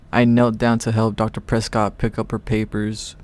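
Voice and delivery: usual speaking voice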